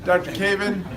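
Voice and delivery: normal talking voice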